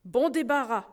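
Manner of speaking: ordinary delivery, nothing marked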